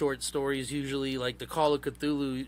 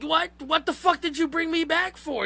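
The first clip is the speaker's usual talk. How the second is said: much higher, squeaky outraged yelp; panicked cult leader; 3 dB louder than usual. Retold or imitated. Revived cult leader demands why he was brought back, squealing high. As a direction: pitch way up in mock panic. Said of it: "And they're like".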